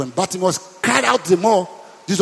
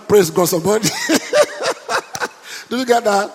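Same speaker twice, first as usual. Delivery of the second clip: ordinary talk, nothing marked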